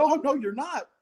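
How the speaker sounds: high-pitched mock protest; alarmed refusal. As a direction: act out a patient's indignant refusal. Patient refuses clothes being cut.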